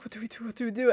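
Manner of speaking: hushed, urgent repeated question; soft mock murmur into an ear. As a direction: imitate a scared companion, softly. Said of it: "Like, in my ear".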